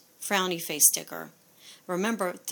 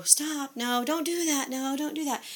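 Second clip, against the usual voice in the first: mock parent's quick higher-pitched "stop, no, don't do that"; nagging parent; 6 semitones higher than usual. Parent nagging a preschooler out of habit, mock higher scolding. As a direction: imitate the reflexive nagging at a preschooler. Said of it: "with preschoolers is that we just go".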